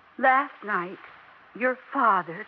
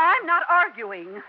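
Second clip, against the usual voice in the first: raised voice; 6 semitones higher, 5 dB louder than usual. Acted. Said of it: "what are we shouting about".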